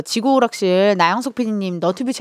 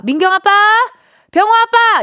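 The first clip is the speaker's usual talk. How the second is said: higher, loud calling across the house; 11 semitones higher, 6 dB louder than usual. Retold or imitated. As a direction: call out to a husband by his kid's name. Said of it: "이렇게 많이들 부르잖아요".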